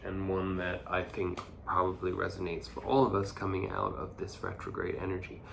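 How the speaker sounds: usual speaking voice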